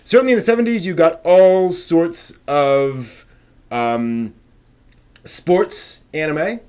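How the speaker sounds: energetic host kicking off the Great Debate; show intro. Acted. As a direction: announce the segment like a stream host. Show host opens the debate segment.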